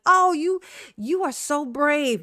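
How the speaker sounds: mock a pitying admirer, stretching the praise slowly; gushing sympathizer. Someone at the meeting patting her back, gushing praise. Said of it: "patted me on my back".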